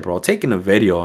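ordinary delivery

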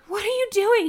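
high, loud retold question; exclaimed in disbelief; alarmed demand. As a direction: re-enact snapping at husband. Challenging husband mid-labor.

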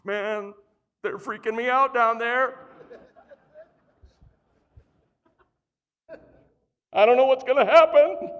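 a hammed-up, laughing God in a panic; mock panicked God. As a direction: ham up a fretting God above. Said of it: "twiddling his thumbs going".

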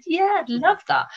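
high, keen retold yes; bright-eyed mock enthusiasm, voice leaping high; eager acceptance. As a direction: jump at the invitation, pitched right up.

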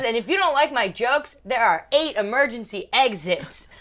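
loud, high cabin announcement; imitated flight attendant. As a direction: announce it boldly like a cheeky attendant.

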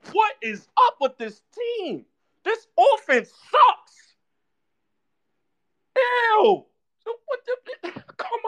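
very high, strained whine of disgust; whiny squeal. Real, not acted. Fan whining at the offense, very high and pained. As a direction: squeal it like you can't stand to watch.

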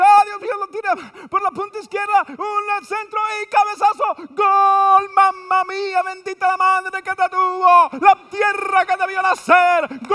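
high, loud shouted goal call; Latin goal call. Retold or imitated. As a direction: explode into the goal like a radio narrator. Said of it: "Y cuando dice".

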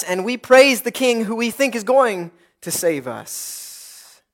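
normal talking voice

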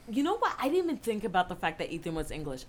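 normal talking voice

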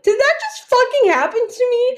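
high, stunned, a touch of tears; replaying the shock of being licked; shocked disbelief. In the bathroom, recalling the shock, high and stunned. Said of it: "I was just like".

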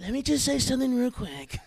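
high-pitched politician impression; Donald Trump. Host teasing Walker with his hero, presidential impression. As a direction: put on the president, asking for the floor. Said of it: "Sounds like Walker's hero talking".

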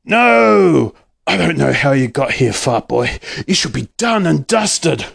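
villain's roar, lightly voiced. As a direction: snarl it out like a cartoon baddie. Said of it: "Doctor Dirt roared".

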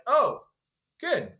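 ordinary talk, nothing marked

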